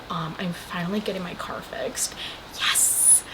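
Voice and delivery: very soft, under the breath; whispered aside. Real, not acted. Vlogger pausing to share car news, whispered aside. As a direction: quietly celebrate in a whisper. Private aside after aunt's text.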